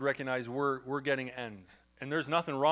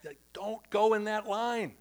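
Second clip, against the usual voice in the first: a higher, slow mock muttered verdict on the line; sizing up the checkout line, voiced a touch higher and slowly; inner shopper voice; 4 semitones higher, slower than usual. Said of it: "it's like".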